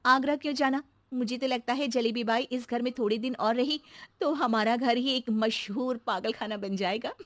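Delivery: ordinary talk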